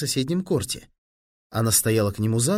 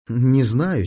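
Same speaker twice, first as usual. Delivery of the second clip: plain reading voice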